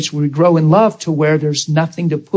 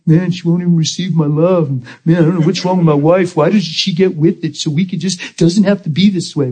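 mock self-pitying grumble; 2 semitones higher than usual. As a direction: grumble like a hurt husband. Acting his own complaining thoughts.

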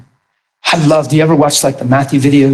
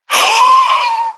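high sharp intake of breath; shocked gasp. Acted. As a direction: gasp in mock horror.

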